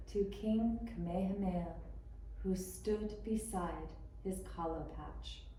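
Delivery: ordinary delivery, nothing marked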